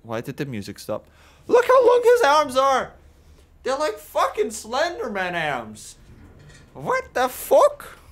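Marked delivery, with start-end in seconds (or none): 1.5-8.0 much higher, incredulous squeal; shocked. Real, not acted. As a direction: gawk at the monster arms in disbelief. Shocked by the tall customer.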